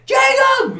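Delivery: very high, loud mock shout; hammed-up frantic yell; calling out. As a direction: holler the name in a high, stretched call. Acts out man shouting for patient.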